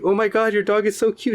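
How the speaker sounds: high-pitched mock gushing; baby-talking dog admirer. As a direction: imitate a lady fussing over a dog. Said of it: "she talks to the dog".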